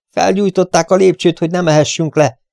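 high, loud urgent warning; urgent alarm. Acted. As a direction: blurt the danger out fast. Dick alarmed by fire.

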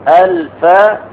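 الفتاة broken into drawn-out parts, ending on a long open "taa"; demonstrating pronunciation. Shown as a demonstration. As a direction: sound out الفتاة slowly, stretching the final "taa". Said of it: "أن تقول".

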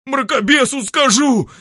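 loud, high frightened threat; threatening. Acted. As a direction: threaten in panic. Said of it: "пригрозил Ремедий".

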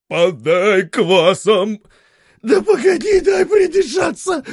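shouting in the steam. A narrator's reading. Bathhouse shouting.

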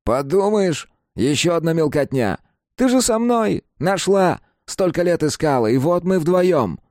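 a dead brother wheedling; teen boy voice. Acted. Kapustin speaks.

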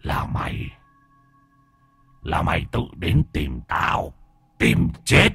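low, soft demon threat, ending harder; menacing demon. Acted. Demon holding the boy up by the neck, quiet menace.

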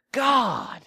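high, loud exasperated groan of God; mock the fed-up mother; imitating an annoyed mom. Mom's exasperated reaction retold.

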